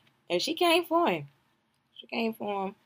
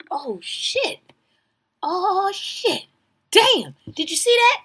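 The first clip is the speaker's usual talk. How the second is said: higher, startled oh shit; shocked reaction; 10 semitones higher, 4 dB louder, slower than usual. Real, not acted. Watching the show, startled by a surprise. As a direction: gasp at what just happened.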